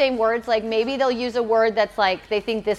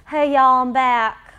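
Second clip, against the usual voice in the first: put-on Southern accent; 2 semitones higher, 6 dB louder, slower than usual. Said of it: "She talked like this".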